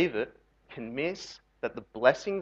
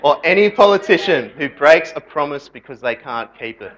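louder retake; 9 dB louder than usual. Shown as a demonstration. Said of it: "Did you see what I just did?".

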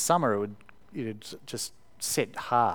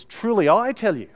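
higher and louder, each word weighted; emphatic Jesus quote; 9 semitones higher, 11 dB louder than usual. Retold or imitated. Preacher voicing Jesus to the congregation, emphatic and loud. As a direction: proclaim it like Jesus making a point. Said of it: "when he wants to make something emphatic".

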